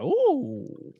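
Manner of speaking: high, drawn-out ooh; hammed-up wide-eyed wonder; mock boyish awe. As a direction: act like a kid thrilled by the sight. Imitates himself as impressed kid.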